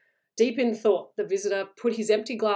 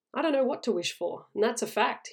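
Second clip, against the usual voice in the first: ordinary delivery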